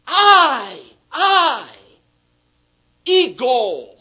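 "I" punched high and loud; emphatic "I"; stress on I. Shown as a demonstration. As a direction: hammer "I", "I", then "ego." loud. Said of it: "emphasis ... upon the one who is speaking".

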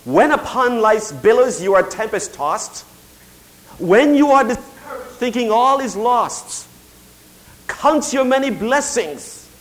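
slow, emphatic spoken verse; hymn recitation. Real, not acted. Preacher quoting hymn writer to congregation, slow declamation. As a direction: recite the hymn with drawn-out weight.

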